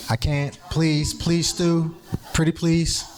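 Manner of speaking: high, sweet pleading; sweetly insistent. Real, not acted. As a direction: coax him into saying yes. Begging the chair for the floor.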